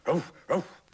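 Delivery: high, loud barking; dog bark. Acted. Dog barking at the ducks, loud barks. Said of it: "thought the dog".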